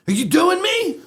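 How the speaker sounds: high, suspicious squeak; Joe Pesci. Retold or imitated. Star asking if he is being mocked, high-pitched impression. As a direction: imitate an offended star in a high voice. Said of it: "I was making fun of him".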